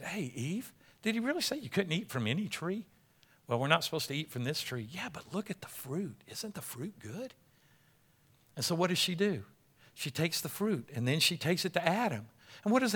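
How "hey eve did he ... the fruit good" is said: soft, hushed tempting voice; quiet serpent. Acted. Preacher voicing the serpent coaxing Eve, hushed. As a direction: lure her in softly.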